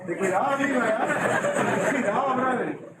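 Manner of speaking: laughing through a mock buddy line; jokey laughing bro voice; teasing laugh. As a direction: tease the class while chuckling. Teacher jokes about friends touching.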